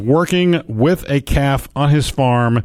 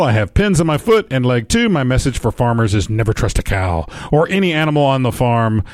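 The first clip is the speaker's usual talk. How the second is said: ordinary talk, nothing marked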